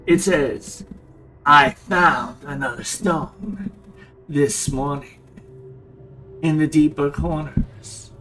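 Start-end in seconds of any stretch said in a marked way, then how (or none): 1.5-8.1 loud, slow, measured declamation; theatrical journal reading. Acted. Player reading a character's journal aloud, theatrical declamation. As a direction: read it out grandly, drawing out the phrases.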